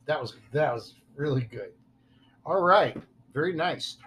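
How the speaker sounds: ordinary delivery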